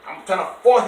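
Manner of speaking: usual speaking voice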